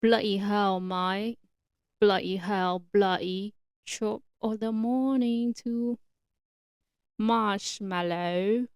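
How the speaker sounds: hammed-up mock British cursing; British accent. Podcaster showing how Brits curse, in a put-on British accent. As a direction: put on a playful British accent, drawing out the last words. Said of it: "And a British accent is a little bit different".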